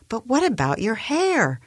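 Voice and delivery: high, incredulous question; agitated disbelief. A narrator's reading. Boss questioning employee's hair colour, high-pitched disbelief.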